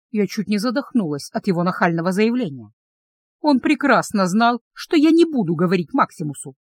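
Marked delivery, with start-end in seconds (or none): none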